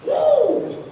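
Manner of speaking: high, loud joyful whoop; excited whoop. Shown as a demonstration. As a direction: let out a big excited holler. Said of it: "you're excited".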